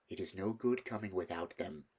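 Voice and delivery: plain reading voice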